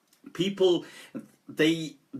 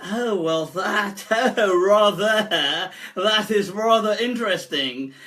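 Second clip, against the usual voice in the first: high, laughing, prim English gent; posh mock voice; 4 semitones higher, 4 dB louder, slower than usual. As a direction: play it prissy and amused.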